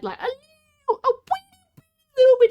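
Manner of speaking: very high tiny wee; squeaky mock sadness. Streamer mock-sad about a snowy game, very high squeak.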